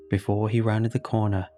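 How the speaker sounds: ordinary delivery, nothing marked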